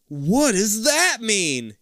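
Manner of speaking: high, very slow puzzlement; exaggerated wondering. Kid puzzling over the warning sign, exaggerated wonder.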